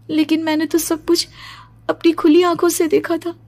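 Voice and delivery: high, agitated protest; distressed disbelief. Acted. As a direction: insist anxiously that it was real. Daughter insists nightmare was real.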